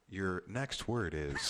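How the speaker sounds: slow, drawn-out bee announcer. Pretend. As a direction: announce the word slowly and grandly.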